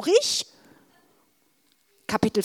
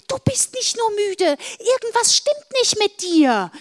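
insistent; 12 semitones higher, 5 dB louder than usual. Acted. Insistent sister.

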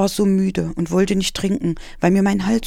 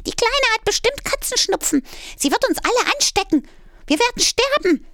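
much higher, haughty and dramatic; arrogant cat lady. Acted. Minna frets about catching the kitten's cold, high and haughty. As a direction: play the snobby queen cat, overdoing the panic.

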